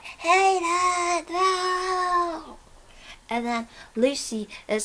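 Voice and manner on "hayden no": high, anguished cry of his friend's name; desperate cry. Retold or imitated. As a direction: cry out in despair as the monster takes him. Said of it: "and Drew's like".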